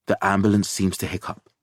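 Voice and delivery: ordinary delivery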